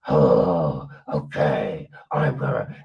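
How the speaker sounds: low, gravelly mock growl; hammed-up deep gravel. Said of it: "develops this whole gravel weirdness to the voice".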